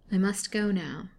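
ordinary delivery, nothing marked

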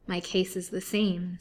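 ordinary delivery, nothing marked

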